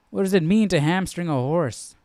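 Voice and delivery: usual speaking voice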